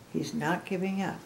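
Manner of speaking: plain reading voice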